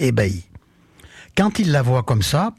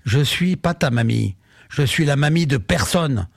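ordinary delivery

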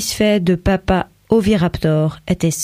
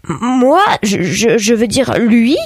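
strangled cry; 4 semitones higher, 3 dB louder, slower than usual. A narrator's reading. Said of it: "il eut un cri étranglé".

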